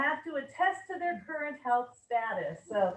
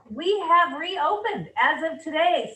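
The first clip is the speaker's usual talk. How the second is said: happy and excited; 3 semitones higher, 9 dB louder than usual. Real, not acted. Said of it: "I'm so happy about that".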